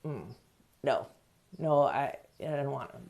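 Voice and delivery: reluctant no. Retold or imitated. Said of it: "it's like".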